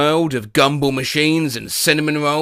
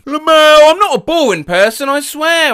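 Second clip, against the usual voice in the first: raised, emphatic protest; loud protest; 10 semitones higher, 9 dB louder than usual. Real, not acted. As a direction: insist loudly, pitched up. Protesting loudly he is not boring.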